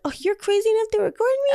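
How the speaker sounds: high, fast, sneering mock of him; mocking the gaslighter. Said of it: "they're like".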